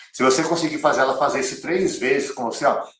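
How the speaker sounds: normal talking voice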